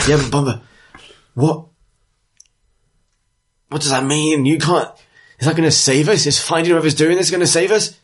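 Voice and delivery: high-pitched, urgent questioning; agitated townswoman. Acted. Dwarf bartender challenging the leader's reassurance, agitated. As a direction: press for answers, high and increasingly insistent.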